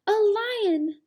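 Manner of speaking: high, loud gasp of alarm; startled cry. Acted. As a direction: cry out in sudden fright. Sudden danger in story.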